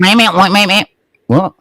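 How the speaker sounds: high childish meh mockery; mocking bleat. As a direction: bleat back at him like a child. Mimicking opponent's bleating.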